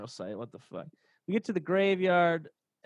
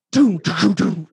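imitated gunshots; 2 semitones higher, 9 dB louder, slower than usual. Mimicking the ragged veterans' salute, loud booms. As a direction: spit out each doom like a gunshot. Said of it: "they was like".

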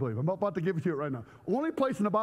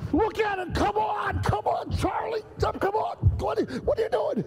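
high, loud urgent shout; 5 dB louder than usual. Acted. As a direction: yell at him to hurry. Yelling at friend to look.

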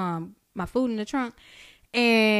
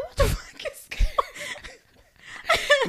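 very high mock-panicked squeal; retold alarm. Telling friend of whipping trees, high laughing disbelief.

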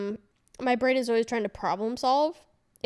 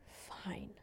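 whispered as if soothing a friend; hushed, drawn-out acted comfort; imitated reassurance; 19 dB quieter than usual. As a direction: drop to a gentle mock whisper.

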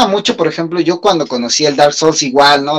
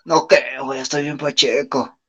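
stoner voice; 3 semitones lower, 7 dB quieter than usual. Retold or imitated. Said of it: "esto es así de".

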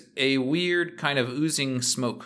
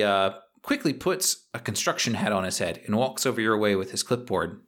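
ordinary delivery

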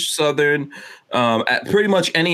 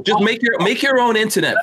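fired up; 10 semitones higher than usual. Real, not acted. Ranting at EU link tax.

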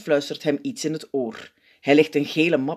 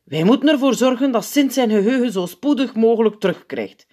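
higher-pitched grave doctor figure; zenuwpiet, ernstig; 7 semitones higher, 4 dB louder than usual. A narrator's reading. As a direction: announce the diagnosis to the hall. (ernstige, hogere zenuwpiet)

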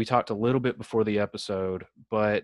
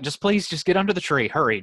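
higher, louder, pressed plea; acted urgent pleading; 7 semitones higher, 5 dB louder, faster than usual. Groom-to-be hurrying her under the tree, exasperated plea. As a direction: imitate begging someone to hurry along.

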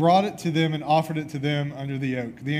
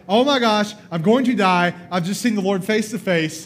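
loud, high panic; frantic oh my gosh, I'm going to die; panicked Gideon; 4 semitones higher, 6 dB louder than usual. Acted. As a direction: sure he's about to die. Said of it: "he's like".

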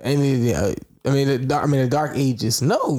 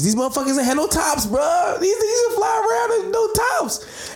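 excited shouting, higher; hyped-up yelling storyteller; frat bro. Retold or imitated. Retelling wild plane story, hyped.

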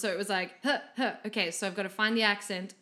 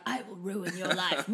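evil villain; 3 semitones lower, 4 dB quieter than usual. Retold or imitated. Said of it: "the other ones were like / the full evil".

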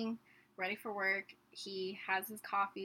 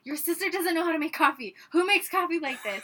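imitating dad's raised, higher-pitched complaint; agitated dad; 5 semitones higher, 7 dB louder than usual. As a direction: grumble loudly as the exasperated father. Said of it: "more agitated as he's pouring coffee".

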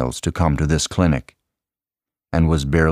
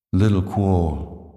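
quiet grief; 3 dB quieter, slower than usual. A narrator's reading. Salva grieves his dead brother.

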